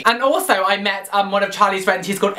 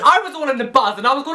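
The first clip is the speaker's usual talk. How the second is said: usual speaking voice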